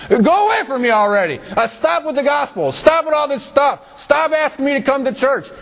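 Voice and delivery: fed-up sinner yelling at the preacher to stop; fed-up unbeliever. Pretend. Preacher voices annoyed unbeliever.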